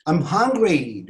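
mildly called-out complaint; exclamation. Shown as a demonstration. Teacher models announcing hunger, exclaimed. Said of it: "Ünlem bağırıyorsunuz".

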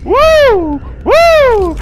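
high loud whoops; real high, loud victory whoops; whooping. Real, not acted. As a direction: cheer like you scored. Celebrating an escape.

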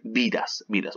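usual speaking voice

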